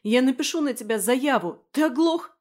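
high, loud outraged yell; girl yelling threats at her captor; furious shout. Acted. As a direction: threaten him at the top of her voice. Carried off, she threatens him.

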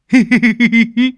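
high, loud gleeful cackle; gleeful boyish cackle; greedy laugh. Acted. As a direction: cackle with delight at food. Tý laughs at the mango.

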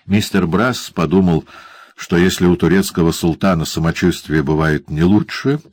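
ordinary delivery

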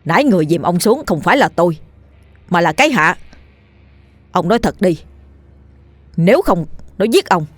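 mildly stern, insistent warning. Acted. Dần warning the old man to confess, sternly. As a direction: firmly press for the truth. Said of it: "Dần nghiêm giọng".

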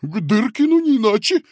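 babbled. Acted. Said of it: "пролепетал толстяк".

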